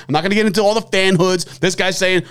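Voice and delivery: usual speaking voice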